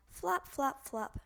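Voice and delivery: ordinary delivery, nothing marked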